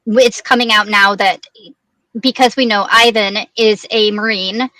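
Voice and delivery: normal talking voice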